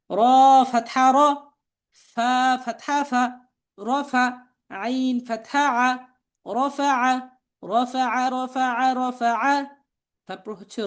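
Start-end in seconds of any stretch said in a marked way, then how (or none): 0.1-9.5 level, measured chant with drawn-out letter names; spelling drill recitation. Shown as a demonstration. Teacher spelling out Arabic words for learners to repeat, chanted drill.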